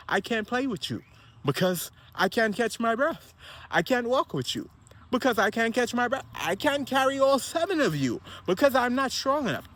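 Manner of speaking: imitating an out-of-shape parent's excuses; mock helpless excuses. Voicing an unfit parent to kids.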